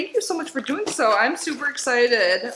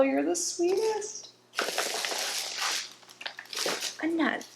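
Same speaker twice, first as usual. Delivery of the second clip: soft, touched gratitude; 4 semitones higher, 10 dB quieter than usual. Real, not acted. Touched by a handmade gift.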